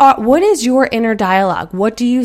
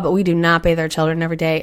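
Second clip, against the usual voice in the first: normal talking voice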